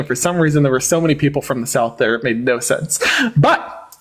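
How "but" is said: higher, a quick mock Southern line; brief Southern accent. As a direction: drop back into the Southern voice for a joke. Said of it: "Southern accent".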